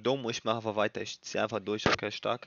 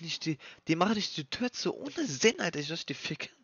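panicked outburst; 7 semitones higher than usual. Real, not acted.

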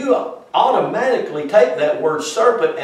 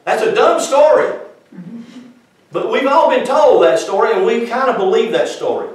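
raised, louder preaching, with a laugh at the start; preaching at full force; 6 semitones higher, 5 dB louder than usual. Acted. Preacher mocking the snake story to the congregation, loud and fervent. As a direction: scoff at the apple story from the pulpit.

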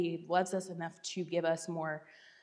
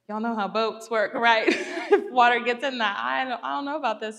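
louder, laughing, panicky retelling of boat fears; half-laughing panic, louder and quicker at the end; freaking out; 4 semitones higher, 8 dB louder than usual. Retold or imitated. Telling friends about the leaky boat, laughing panic. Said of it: "So I'm freaking out the whole time".

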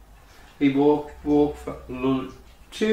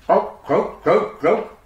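short imitated croaks, slightly raised; frog croaks; 3 semitones higher, much slower than usual. Storyteller imitates a frog in the pond, croaking.

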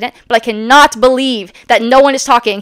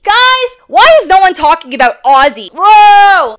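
real heated shout, higher and louder; fired-up outburst; 9 semitones higher, 9 dB louder than usual. Real, not acted. Fired up shouting at the audience about [name]. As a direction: burst out, truly fired up. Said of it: "I'm getting fired up".

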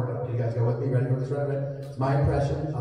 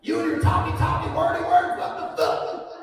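high-pitched mocking voice for a confused local; baffled heckler; 12 semitones higher than usual. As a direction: imitate an indignant audience member, pitched way up. Mocks a baffled Alabama heckler.

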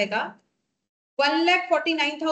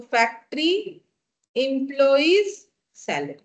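word by word, long pauses between; spaced out for note-takers; slow dictation. Real, not acted. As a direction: dictate "factory", "employees", "salary" one at a time. Dictates item for students to write.

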